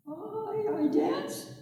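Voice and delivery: a tiny frightened creature answering; high little squirrel voice; timid squirrel. A narrator's reading.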